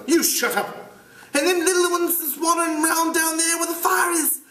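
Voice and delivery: put-on high, aggressive boy's voice; Jack's voice. Jack snapping at Piggy. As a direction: bark it at him.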